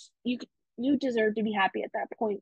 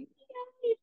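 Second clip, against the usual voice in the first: soft yay; 12 semitones higher, 6 dB quieter than usual. Retold or imitated. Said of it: "I was like".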